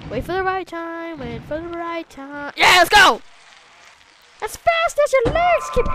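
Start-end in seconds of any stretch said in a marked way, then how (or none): 4.4-5.9 high loud cheering shout; excited shout. Real, not acted. Gamer cheers runner on, high loud shout. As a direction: cheer them on at full tilt.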